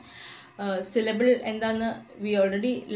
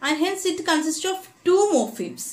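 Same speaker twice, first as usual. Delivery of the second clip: higher, slow stress on two morphemes; emphatic teaching; 7 semitones higher, much slower than usual. Real, not acted. Teacher concluding for students, slow and emphatic. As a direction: land the conclusion firmly.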